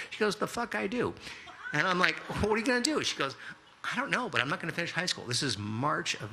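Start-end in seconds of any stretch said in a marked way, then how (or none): 0.4-1.1 high-pitched mock-indignant comeback; defiant retort. Girlfriend's retold defiant retort. 2.4-3.0 high, mock-alarmed disbelief; startled question. Retold startled question to girlfriend. 3.8-5.2 fast, high-pitched mock defiance; defiant teen. Girlfriend's retold defiant answer.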